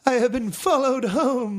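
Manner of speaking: high, loud, laughing mock voice; exaggerated high-pitched parody. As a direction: ham up a shrill indignant claim. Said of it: "play that again".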